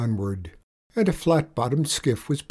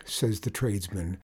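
ordinary delivery, nothing marked

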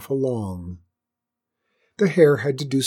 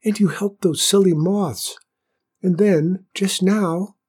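high, small, admiring flower voice; bashful little admirer; shy whispering flower; 8 semitones higher, 3 dB louder than usual. A narrator's reading. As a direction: praise shyly in a tiny high stage whisper. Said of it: "whispered the flower".

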